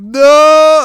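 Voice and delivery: very high, loud mock scream; hammed-up terrified shout. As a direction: scream for help against the aliens. Retold scream for help.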